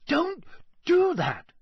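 high, slow, emphatic protest; alarmed scolding. A narrator's reading. Harry, nearly falling out of bed, scolds Dobby, alarmed. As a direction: scold him in a high, startled voice.

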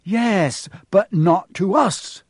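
loud, high, drawn-out exasperation; exasperated. A narrator's reading. Ron losing patience with Neville's stand, exasperated. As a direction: stretch it out, thoroughly exasperated. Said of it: "said Ron in exasperation".

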